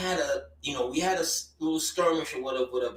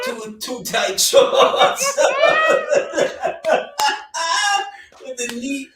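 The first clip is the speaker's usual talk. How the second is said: laughing; 12 semitones higher, 7 dB louder than usual. Real, not acted. Laughing at old outfit.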